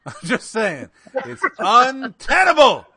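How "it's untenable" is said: loud, high, laughing declaration; emphatic exclamation. Real, not acted. Host saying "I'm just saying", emphatic exclamation. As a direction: belt it out, stretching untenable. Mock-emphatic repeat of his verdict.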